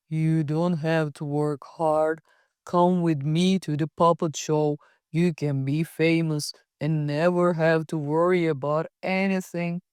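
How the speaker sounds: sly Honest John. A narrator's reading. Sly man tempting Pinocchio.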